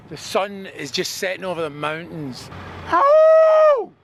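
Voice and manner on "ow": a high, half-hearted mock yelp; weak fake ow. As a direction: fake a little ow of pain, barely trying. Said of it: "That was a, a weak ow".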